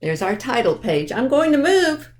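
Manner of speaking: usual speaking voice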